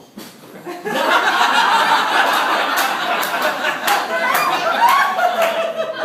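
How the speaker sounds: long high breathy laughter. Real, not acted. Laughing at the haggling woman. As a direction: crack up mid-story.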